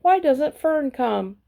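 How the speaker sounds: put-on a scared pig wishing for his girl; lightly voiced slow fretful piglet; Wilbur longing for Fern. A narrator's reading. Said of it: "thought Wilbur".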